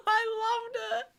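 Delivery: gushing. Real, not acted. Still gushing after the aww.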